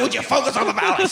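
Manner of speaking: high, fast irritated snap; a hammed-up outburst at the gawkers; exasperated gunslinger. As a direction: scold them to focus. Bruder snaps at them.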